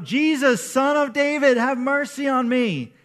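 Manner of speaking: a beggar crying out to be heard over a crowd; shout. Retold or imitated. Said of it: "so he starts to shout".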